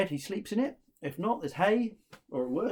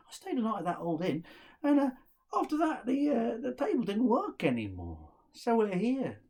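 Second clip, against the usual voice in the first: sorry second brother; 6 semitones higher than usual. Acted.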